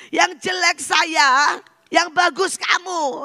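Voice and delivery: very high, drawn-out mock protest; protesting to God. Father objects to God's unfair deal, high protest. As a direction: stretch it out in a high indignant complaint. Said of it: "Jadi dia protes sama Tuhan".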